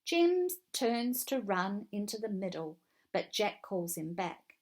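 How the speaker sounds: ordinary delivery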